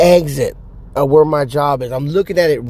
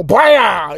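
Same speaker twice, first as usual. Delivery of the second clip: mock shotgun blast; 4 semitones higher than usual. Old man firing into Daniel's coffin, a mock gunshot. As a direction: blast it with your voice.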